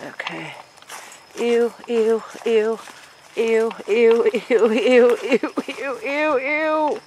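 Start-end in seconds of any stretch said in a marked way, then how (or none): 1.4-7.0 high, loud repeated ew; disgusted. Real, not acted. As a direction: squeal of disgust at spider. Sees a spider.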